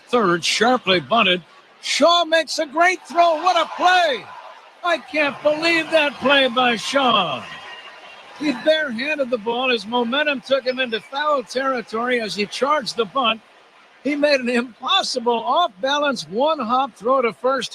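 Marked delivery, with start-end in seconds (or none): 1.8-3.4 rising excitement, voice jumping up high; excited call. Real, not acted. Call of a spectacular defensive play. 3.4-4.2 high, shouted burst of amazement; excited shout. Real, not acted. Exclaiming at the play, shouted. 4.8-17.7 amazed. Real, not acted. Marvelling at the play afterwards.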